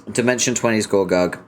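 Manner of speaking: ordinary talk, nothing marked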